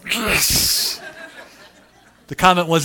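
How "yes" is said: wives' chorus of yes. Retold or imitated. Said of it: "all wives just went".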